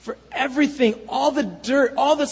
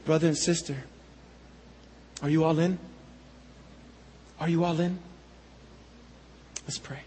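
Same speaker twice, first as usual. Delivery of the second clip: soft, lower appeal to the church; 5 semitones lower, 9 dB quieter, faster than usual. Real, not acted. Pastor gently asking the congregation to commit, softly. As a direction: ask it gently, inviting. Altar call after song.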